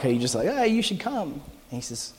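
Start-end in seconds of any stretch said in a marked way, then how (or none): 0.5-1.3 tossed-off casual invite; breezy no-pressure invitation; offhand, like a lark. Shown as a demonstration. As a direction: throw it away lightly, almost a joke. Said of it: "like a lark".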